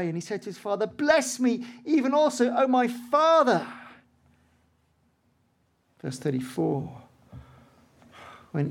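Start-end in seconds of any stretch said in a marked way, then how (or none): 0.9-3.7 pleading. Acted. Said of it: "bitter cry".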